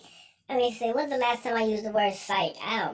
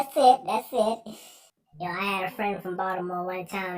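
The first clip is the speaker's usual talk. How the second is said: ordinary delivery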